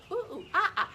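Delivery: high, loud playful monkey calls; monkey sounds. Pretend. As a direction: hoot like a cheeky monkey for the kids. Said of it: "make monkey sounds".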